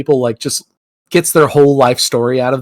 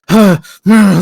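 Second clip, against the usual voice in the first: higher, louder irritated huffs; pissed-off huffs; 5 semitones higher, 6 dB louder than usual. Retold or imitated. Tow truck driver huffing, imitated pissed-off huffs. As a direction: imitate the guy's annoyed snorts. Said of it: "acting real pissed".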